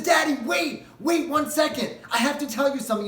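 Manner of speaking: high, loud tearful plea; desperate cry to the departing king; daughter crying out. Acted. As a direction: call after her father in tears. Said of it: "And she's crying".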